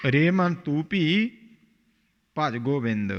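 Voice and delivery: ਰੇ ਮਨ ਤੂੰ ਭੀ with a clear pause after ਭੀ, then ਭਜ Gobind. Shown as a demonstration. Teacher showing the student where to pause, a clear break after ਭੀ. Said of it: "ਭੀ ਤੇ ਵਿਸਰਾਮ ਹੈ".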